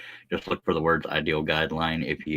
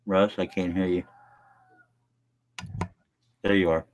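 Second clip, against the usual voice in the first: talking while still chewing; words through a mouthful of food; mouth full; 3 dB louder than usual. Real, not acted. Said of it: "I got my mouth full".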